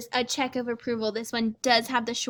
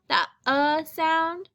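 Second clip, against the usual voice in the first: that uh sound, slow and higher, leaning on uh; modeled uh; 4 semitones higher, slower than usual. Shown as a demonstration. Teacher models the short vowel slowly. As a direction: hold the uh sound up for the class. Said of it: "teacher models vowel".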